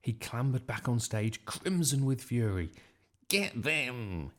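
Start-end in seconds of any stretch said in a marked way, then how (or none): 3.3-4.3 restrained, drawn-out battle cry; a contained yell of command. A narrator's reading. Nick crimson with fury, mildly acted yell. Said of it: "he yelled".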